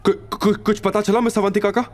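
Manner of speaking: panicky, stammering high voice; flustered stammer. Acted. Assistant asks his boss's news in a panic. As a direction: stumble over the first word in a panic. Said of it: "हड़बड़ी में पूछा".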